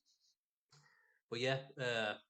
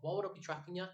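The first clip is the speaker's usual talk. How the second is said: retold irate retort, pitched higher; mock exasperated question, higher; rising irritation at the paranoid driver; 8 semitones higher, faster than usual. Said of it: "I'm getting a bit irate as well".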